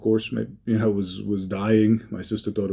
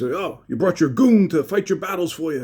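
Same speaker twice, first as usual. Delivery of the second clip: mock angry yell, higher and louder; retold scream; 8 semitones higher, 4 dB louder than usual. Brother taunts Herm at wedding, mild mock yell. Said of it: "his brother screams".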